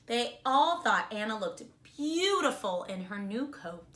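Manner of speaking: put-on reading a storybook to children; bright, expressive read-aloud; kindergarten teacher voice. A narrator's reading. Teacher reads picture book aloud.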